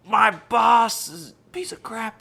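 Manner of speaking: high, loud imitation of a friend yelling; mock yell. Coworker quoting her friend venting in a rage room, mock yelling. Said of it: "yelling her boss's name and breaking things".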